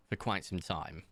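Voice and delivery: normal talking voice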